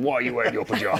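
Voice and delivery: lightly voiced deep mum voice; mock your mum in a slightly deep voice. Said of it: "Very deep, deep voice".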